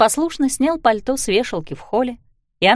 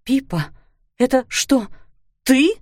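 breathless; 4 semitones higher than usual. A narrator's reading. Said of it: "выдохнула она".

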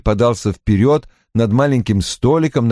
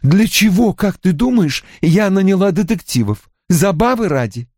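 higher-pitched indignant questioning; raised voice; 9 semitones higher than usual. A narrator's reading. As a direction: raise your voice in challenge. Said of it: "она повысила голос". (повышенным голосом, с возмущением)

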